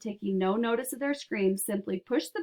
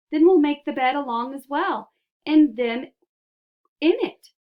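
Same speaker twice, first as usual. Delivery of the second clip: loud, high, gleeful insistence; rising into a triumphant high-pitched cry; excited; 6 semitones higher, 9 dB louder than usual. A narrator's reading. Wonka brushing off Charlie's worry, loud and excited.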